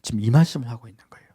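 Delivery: hushed, intimate whisper; whispered tenderness; tender whisper. Real, not acted. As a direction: whisper it as a secret of love. Tender climax of sermon.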